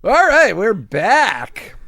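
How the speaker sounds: high, loud announcer-style call; big show-return shout. Real, not acted. Podcast host bringing listeners back from a break, loud excited call. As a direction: belt out the welcome back after the break.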